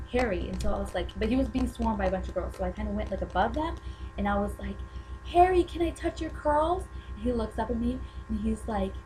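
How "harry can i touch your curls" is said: high, eager fangirl request; reenacted fan plea. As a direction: ask your idol in an excited high voice. Replays calling out to singer.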